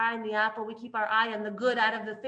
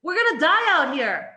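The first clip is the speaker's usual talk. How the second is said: loud, higher, calling out to the leader; demanding crowd; 5 semitones higher, 8 dB louder than usual. Retold or imitated. Thirsty people shouting at Moshe for water, loud and desperate. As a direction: yell for Moshe's attention. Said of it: "And then they're like".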